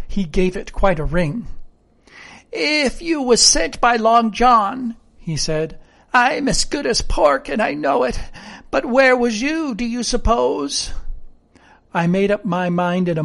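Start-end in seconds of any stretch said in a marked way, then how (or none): 2.5-5.0 slow, higher sailor voice; suspicious rustic sailor; wary castaway character. Acted. 6.1-10.9 suspicious rustic sailor; wary castaway character. Acted.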